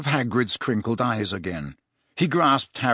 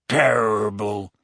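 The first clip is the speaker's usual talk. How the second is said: grunt; 2 semitones lower, slower than usual. A narrator's reading. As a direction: grunt the word out half asleep. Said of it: "Hagrid grunted".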